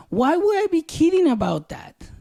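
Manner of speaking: high-pitched disbelieving comeback; incredulous retort. Retold or imitated. Husband answers wife who thought he joked, incredulous.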